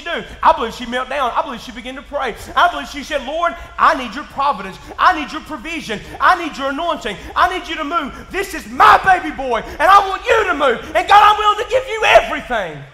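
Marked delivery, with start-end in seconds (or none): 3.2-12.8 building from steady plea to loud, high cry; desperate mother's prayer. Retold or imitated. Moses' mother begging God to save her baby, rising to a shout. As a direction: pray harder on every line.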